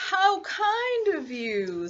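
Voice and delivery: high, very slow, drawn-out praise; flattering wolf. Acted. Wolf flattering Little Red, slow oily charm. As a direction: lay on the charm, stretching each word.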